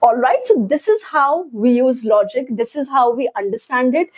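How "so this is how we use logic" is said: ordinary talk, nothing marked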